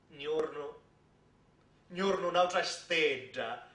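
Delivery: ordinary delivery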